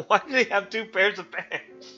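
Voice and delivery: ordinary delivery, nothing marked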